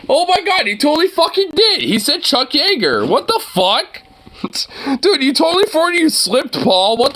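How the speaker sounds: can't believe what he just heard; squealing with shocked delight; shocked disbelief. Real, not acted. Shocked at a Freudian slip.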